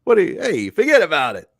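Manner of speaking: loud mobster-style Italian accent; hammed-up Italian accent. Shown as a demonstration. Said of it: "I can do the stere-, stereotypical one".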